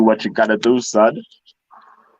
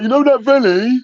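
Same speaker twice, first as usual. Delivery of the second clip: high, loud dopey voice; 3 dB louder than usual. Retold or imitated. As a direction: ham it up like a dim mate. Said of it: "This fucking loud dopey voice".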